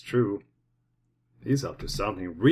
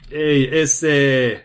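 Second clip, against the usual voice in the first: put-on Chicano accent; 5 dB louder than usual. Greeting from a lowrider driver.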